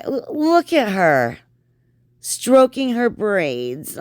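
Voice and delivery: high mocking voice. Mocking the vlogger's gestures.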